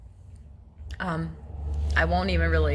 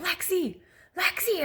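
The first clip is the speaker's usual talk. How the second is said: much higher, panicked calling out; retold scream; 12 semitones higher than usual. Mother calling to her daughter over the phone, retold screaming. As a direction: re-enact screaming for your daughter. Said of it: "I'm screaming her voice".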